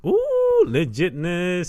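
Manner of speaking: squealing ooh, then slow drawn-out praise; impressed ooh. Real, not acted. Host impressed by the custom domino table, squealing ooh. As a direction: gush at the news.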